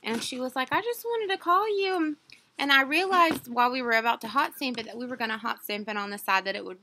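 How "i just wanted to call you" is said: lightly voiced sales clerk, pitched up high; a hint of a cheerful clerk calling; chirpy saleswoman on the phone. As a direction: mimic her bright phone greeting.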